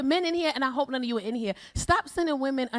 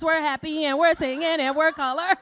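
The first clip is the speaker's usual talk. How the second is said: normal talking voice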